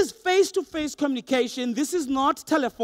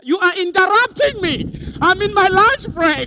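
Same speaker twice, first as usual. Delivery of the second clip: high, loud, indignant protest; hammed-up lunchtime outrage; irritated receptionist; 6 semitones higher, 6 dB louder than usual. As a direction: scold someone for interrupting your break. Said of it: "the tone is telling me".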